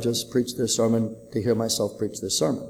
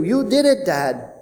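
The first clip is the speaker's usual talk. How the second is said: put-on high, louder voice of a trapped boy; young son calling up from rubble; child's voice; 12 semitones higher, 9 dB louder than usual. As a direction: play the relieved little son. Trapped son answers his father.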